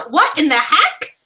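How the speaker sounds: put-on high, slow childlike "What in the heck?"; imitating toddler. Mother imitating her three-year-old: high childlike voice. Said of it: "my three-year-old is adapted now".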